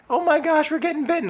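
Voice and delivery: mock high-pitched complaining kids; whiny daughters. Daughters complaining about bug bites, high mocking whine. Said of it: "my daughters are like".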